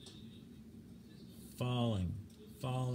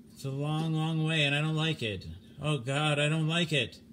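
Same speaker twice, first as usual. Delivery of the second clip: higher, louder, panicked wail; a frightened man losing his nerve; rising panic; 4 semitones higher, 3 dB louder than usual. Acted. Stimson panicking as he falls through space, frightened wail.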